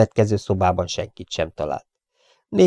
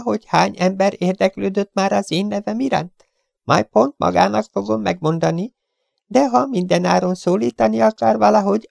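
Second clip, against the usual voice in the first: high, curt, insolent gangster voice; rude Chiefen; 13 semitones higher, 4 dB louder than usual. Acted. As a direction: sneer the intruder off. (magas, pimasz, goromba hang)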